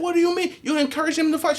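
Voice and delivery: high, loud mock mom outrage; imitating furious mom, pitched high and loud; angry mom. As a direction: scold like an outraged mother. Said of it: "She said".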